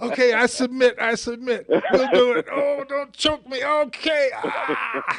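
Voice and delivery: high, loud laughing mock surrender; hammed-up choked surrender; being squeezed hard. Pretend choked into submission.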